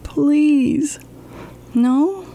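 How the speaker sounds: usual speaking voice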